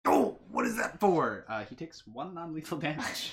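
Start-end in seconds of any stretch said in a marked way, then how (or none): 0.1-1.4 loud, startled protest; indignant protest. Acted. [name] gets smacked in the belly, loud indignant protest. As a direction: cry out as if just smacked.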